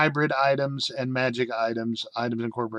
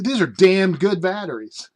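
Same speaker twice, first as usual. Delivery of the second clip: higher, louder hammed-up company boast; enthusiastic mock sales pitch; 5 semitones higher, 5 dB louder than usual. Company naming its hellish batteries, mock-enthusiastic boast. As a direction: play the proud battery seller. Voicing company discovering hell.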